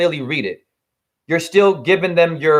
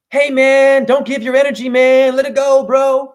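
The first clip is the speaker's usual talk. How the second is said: chill bro; 7 semitones higher, 4 dB louder than usual. Retold or imitated. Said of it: "the loving God that we all think is just".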